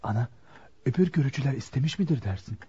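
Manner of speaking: hushed aside. Acted. Son asks his mother quietly whether the other suitors won, hushed. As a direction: keep it under your breath so the hosts can't hear.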